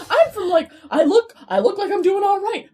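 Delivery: high, loud defensive rant; hammed-up indignation, voice pushed right up; mock-mad tangent. Teen insisting to crush she only looks well-off, mock-indignant. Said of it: "I went on this whole tangent".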